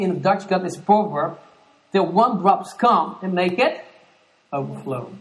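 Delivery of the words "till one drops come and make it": ordinary talk, nothing marked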